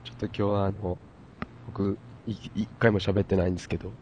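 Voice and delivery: hoarse, stuffy voice from a cold; nasal cold voice. Real, not acted. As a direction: talk through a blocked nose. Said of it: "すごい鼻声".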